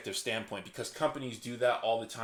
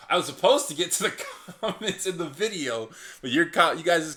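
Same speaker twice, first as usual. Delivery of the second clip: laughing; 3 semitones higher, 6 dB louder than usual. Real, not acted. Laughs at going off track.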